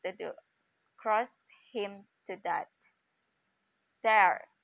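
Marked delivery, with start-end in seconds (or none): 4.0-4.5 sharp loud "There"; crying out. A narrator's reading. Sparrow crying out over the dead dog, loud. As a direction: fling the word out in anger. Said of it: "cried the sparrow".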